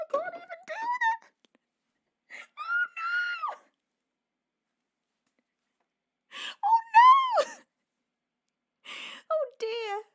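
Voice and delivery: real overwhelmed, high tearful wail; crying with joy. Real, not acted. Streamer overwhelmed by viewers' gifts, crying. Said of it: "I'm just sitting here crying".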